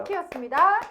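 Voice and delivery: loud, bright TV sign-off; weather caster sign-off. Acted. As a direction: close the forecast like a presenter. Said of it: "기상캐스터처럼".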